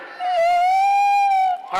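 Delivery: long, very high, loud amazed whoop; a long held woo of wonder. Retold or imitated. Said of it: "you're gonna be like".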